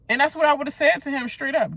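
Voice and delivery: usual speaking voice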